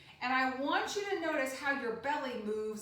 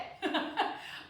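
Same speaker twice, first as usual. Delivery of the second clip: short unvoiced chuckle; real airy laugh; 6 semitones higher than usual. Real, not acted. Teacher laughs at kids' attempt.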